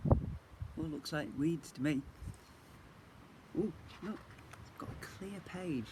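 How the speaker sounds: usual speaking voice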